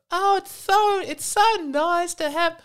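very high, whining bad impression; Bill Burr. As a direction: do a hammed-up, high-pitched comedian impersonation. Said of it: "go straight into Bill Burr".

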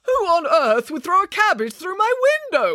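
high-pitched, outraged wail; acted indignant outcry. A narrator's reading. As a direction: wail in exasperated disbelief. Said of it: "wailed Mister Green".

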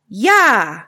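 a father's loud yell; very loud Yah. Acted. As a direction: bark out the noise. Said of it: "very loud".

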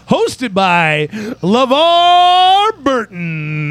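mock announcer. Announcer intro, very slow.

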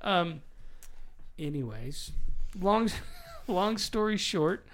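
ordinary talk, nothing marked

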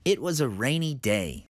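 ordinary delivery

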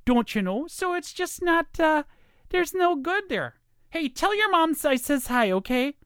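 high female voice with a Minnesota accent. Shown as a demonstration. Woman grumbling over tapioca pudding and bowling, Minnesota accent. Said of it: "like, from Minnesota".

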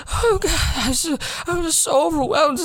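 high, whiny mock overwhelmed voice; squeaky fake despair; mock overwhelmed student. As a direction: parody a panicking classmate. Mocking overwhelmed classmates.

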